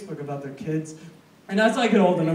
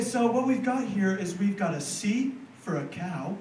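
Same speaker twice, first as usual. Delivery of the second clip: talking down slowly; 5 dB quieter than usual. Retold or imitated. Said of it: "They would talk slower".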